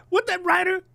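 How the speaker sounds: high mock outraged shout; mock yell. Quoting fan yelling at the writer, mock outrage. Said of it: "she's like".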